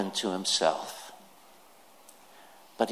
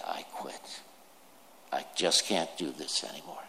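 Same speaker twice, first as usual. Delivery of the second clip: weary whisper; 7 semitones lower, 8 dB quieter than usual. Retold or imitated. Chronically ill person giving up.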